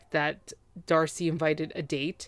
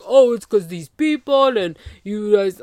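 louder, mocking whine; mock whining ex; 3 semitones higher, 8 dB louder than usual. Ex blames everyone else, mock whining. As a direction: put on the ex's petulant excuse.

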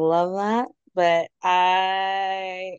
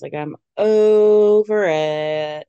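loud, slow and fed up; 2 semitones higher, 9 dB louder, much slower than usual. Real, not acted. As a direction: drag it out, done with it all. Fed up with a cast member.

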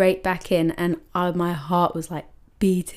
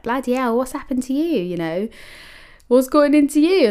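higher, alarmed mock concern, louder at the end; shocked manager; 7 semitones higher, 4 dB louder than usual. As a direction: boss asking what's wrong. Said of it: "Helen, at the time was like".

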